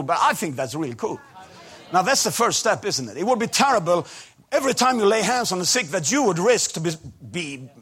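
loud, high, fervent preaching; preaching at full force. Acted. Preacher rousing the congregation about healing, preaching at full force. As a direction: drive it home to the crowd like a revival preacher.

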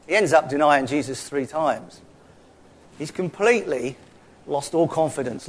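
usual speaking voice

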